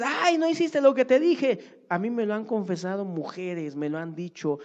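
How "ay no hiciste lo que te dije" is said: mock scolding mother.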